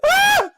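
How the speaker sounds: sudden very high loud yelp of shock; shocked scream. Real, not acted. Streamer shocked by what appears on screen, high scream. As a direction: let out a piercing shriek of surprise.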